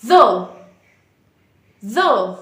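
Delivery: the said with tongue between teeth, voiced th as in "the"; sharp voiced dental the; correct voiced th. Shown as a demonstration. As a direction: put the tongue out and buzz the the. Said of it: "इसकी सही pronunciation होगी".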